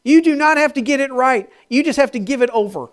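loud, high, driving preacher voice; fervent preaching. Acted. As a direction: preach it out to the crowd with fire. Preaching at full force.

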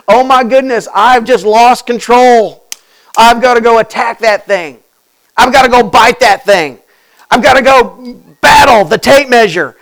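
loud, worked-up dog's inner voice; the dog losing it over the tape measure; frantic dog. Retold or imitated. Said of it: "when I hear the sound of the tape measure thinking".

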